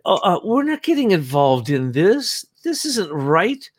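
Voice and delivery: imitating a Russian official in a Russian accent. Mimicking Molotov for the host in a Russian accent. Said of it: "watch your Russian accent".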